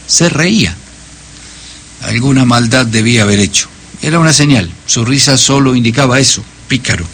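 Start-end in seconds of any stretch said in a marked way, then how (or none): none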